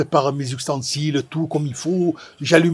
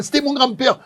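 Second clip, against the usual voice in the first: excited shout; 9 semitones higher than usual. Retold or imitated. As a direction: burst out with it, proud and thrilled. Excited stranger's big reveal.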